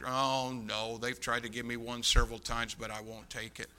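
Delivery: low, soft dismissal; offhand refusal. Retold or imitated. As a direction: wave it away quietly, dropping off. Voicing Mattingly's modest dismissal.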